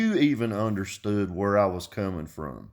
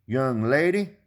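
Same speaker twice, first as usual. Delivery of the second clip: lightly voiced drawn-out introduction; slow, higher-pitched role-played greeting; Earl; 6 semitones higher, slower than usual. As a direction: stretch out a friendly hello to a young lady. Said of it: "if I said".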